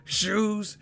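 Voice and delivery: high, slow, squealing fan voice; shrieking fake excitement over shoes; mock hyped fan. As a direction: ham up the gushing fan, stretching each word. Mocking hyped fan, shouting.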